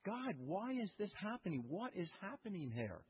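soft, hushed plea to God; quiet questioning. Retold or imitated. Believer quietly questioning God about hardship. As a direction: murmur the question upward, bewildered. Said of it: "We start questioning God".

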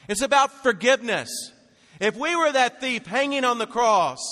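high, loud sermon delivery; impassioned preaching. Real, not acted. Preacher to congregation on forgiveness at the cross, impassioned.

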